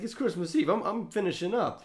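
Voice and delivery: high-pitched acted protest; protesting excuse. Cop acted protesting he is done.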